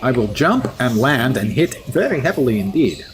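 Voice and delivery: usual speaking voice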